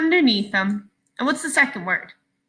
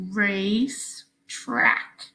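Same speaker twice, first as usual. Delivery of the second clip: very slow, race and track held apart; 4 dB quieter, much slower than usual. Shown as a demonstration. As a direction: stretch Racetrack out, one syllable at a time. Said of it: "Slow it down if you need to".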